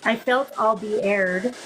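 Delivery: spelling it out slowly, word by word; slow repeat. Shown as a demonstration. Repeating the heard words slowly for the viewers. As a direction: repeat it slowly so others catch it. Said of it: "I just heard a female".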